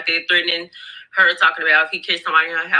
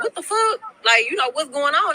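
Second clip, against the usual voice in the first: much higher, startled what the fuck; mock alarm at the horn outside; woman hears him honking outside, startled and confused; retold shock; 7 semitones higher than usual. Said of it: "And she just like".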